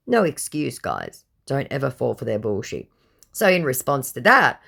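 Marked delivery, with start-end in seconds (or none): none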